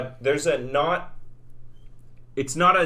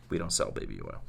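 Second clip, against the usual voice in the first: deadpan store voice; 10 dB quieter than usual. Retold or imitated. Costco voiced in story.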